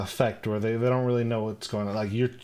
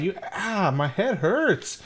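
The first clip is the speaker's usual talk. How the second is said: put-on whiny kid; 7 semitones higher, slower than usual. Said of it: "And I'd be like".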